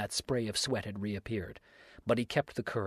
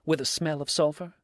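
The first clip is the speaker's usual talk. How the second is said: high, louder mocking question; sneering; 5 semitones higher, 6 dB louder than usual. A narrator's reading. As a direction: needle him with a sneer. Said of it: "sneered Dix".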